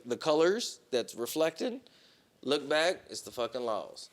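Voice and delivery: plain reading voice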